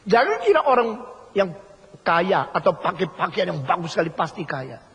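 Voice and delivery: ordinary talk, nothing marked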